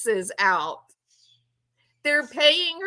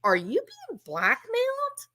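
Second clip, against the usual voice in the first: high-pitched mock disbelief; imitated incredulous question; incredulous son; 11 semitones higher, 3 dB quieter than usual. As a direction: let the question soar in bewilderment. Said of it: "you can hear the incredulity in his voice".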